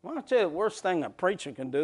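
ordinary talk, nothing marked

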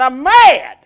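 loud sulky "I'm mad"; Samson's grudge, hammed up loud; mock angry Samson. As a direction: blurt out the anger. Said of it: "That's his attitude".

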